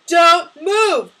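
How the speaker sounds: loud, high, forceful command; yelling. A narrator's reading. Masked gunman threatening his victims, yelling. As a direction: yell DON'T MOVE, both words punched. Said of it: "the figure yelled".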